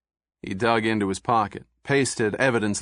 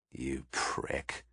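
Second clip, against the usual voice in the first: much softer, low under-the-breath curse; muttered curse; 9 semitones lower, 12 dB quieter than usual. Acted. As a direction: spit it out barely audible. Beaten suspect curses under breath.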